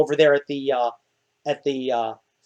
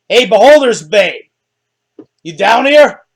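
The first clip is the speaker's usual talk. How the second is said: calling down; 4 semitones higher, 9 dB louder than usual. Acted. NPC calls down the ladder.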